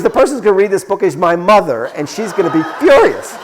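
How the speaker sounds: high, loud mock outburst, quickening; retold shouting. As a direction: imitate an exasperated caller building to the punchline. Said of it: "but he's shouting".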